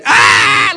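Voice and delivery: loud high-pitched yell; sudden "ah" screamed at full volume; hollered scream. Retold or imitated. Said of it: "I start hollering".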